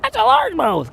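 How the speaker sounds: retold squeak, way up high; excited squeak. Angler retelling his shriek at seeing a largemouth, excited squeak. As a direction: mimic your own startled squeal on spotting the fish. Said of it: "He swam by and I said".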